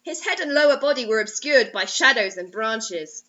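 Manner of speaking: normal talking voice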